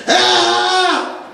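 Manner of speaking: ordinary talk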